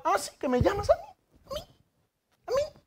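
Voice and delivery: vocecita muy aguda de incrédulo; incredulidad fingida. Retold or imitated. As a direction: pregunta incrédulo, cada vez más agudo y bajito. Gedeón incrédulo ante la llamada. (asombro agudo de parodia)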